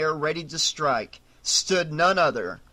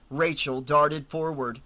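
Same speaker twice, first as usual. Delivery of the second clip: plain reading voice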